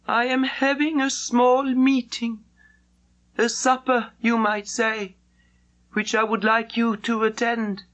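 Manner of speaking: courteous, measured official invitation; the Mandarin. Acted. Mandarin inviting Gladys to a last supper, formal and courteous.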